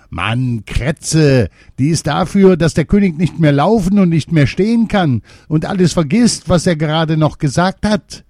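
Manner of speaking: high, loud know-it-all gnome voice; fat gnome. A narrator's reading. Fat gnome explaining the poison to the little one, high and loud. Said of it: "antwortete der Dickste".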